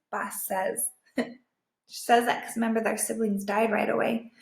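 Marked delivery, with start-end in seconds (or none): none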